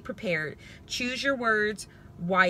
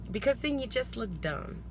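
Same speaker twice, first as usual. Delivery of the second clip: normal talking voice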